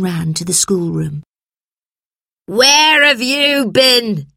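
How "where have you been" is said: high, loud, drawn-out scream; screamed demand. A narrator's reading. Teacher screams at late children, loud and high. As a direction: scream it slowly as an angry demand. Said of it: "screamed a voice".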